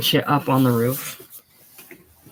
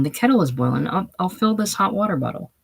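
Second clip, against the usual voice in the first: ordinary talk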